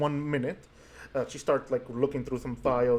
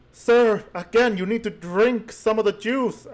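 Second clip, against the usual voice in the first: nurse voice; 8 semitones higher, 4 dB louder than usual. Acted. Nurse scolding patient.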